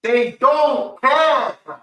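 high, slow, loud outraged wail; high wailing complaint. Real, not acted. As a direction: cry out at the indifference. Indignant outcry.

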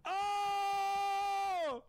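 high, loud excited yell. Real, not acted. Streamer hyped at the game, high loud yell. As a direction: yell out in sudden hype.

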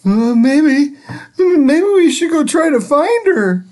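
put-on worried children suggesting a plan; kids unsure. The kids wonder aloud about finding Miss Nelson, high and hesitant. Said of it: "they said".

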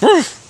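high, loud imitated woof; mock bark. Owner imitating her frustrated dog barking at his sister, mock woof. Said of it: "he'll be like".

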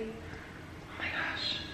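very slow, breathy whispered oh my gosh; stretched-out whispered exclamation. Real, not acted. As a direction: drag out "gosh" in a hushed laughing breath. Laughing aside after "Stop.

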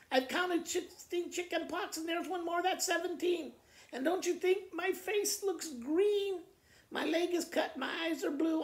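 put-on high, whiny little-girl complaint; whiny sick child.

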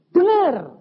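loud, high single-word shout; shouted insistence. Retold or imitated. Pastor insists the comatose wife can hear, shouted. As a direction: shout it with total conviction.

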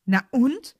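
loud, high defiant cry; called out. A narrator's reading. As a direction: fling it back at them, loud. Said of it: "rief Harry".

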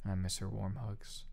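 ordinary delivery, nothing marked

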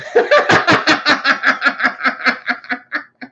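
long, high-pitched burst of laughter; cracking up laughing. Real, not acted. Teller laughing at his own leprechaun punchline, cracking up. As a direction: crack up hard and let it run on.

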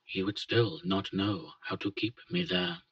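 plain reading voice

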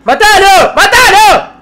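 very high, very loud panicked screams; terrified screaming. Real, not acted. Gamer shrieking at the enemy attacking him. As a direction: shriek in real terror at the attacker.

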